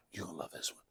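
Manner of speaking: conspiratorial whisper. Real, not acted. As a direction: lean in and whisper it. Aside to the audience.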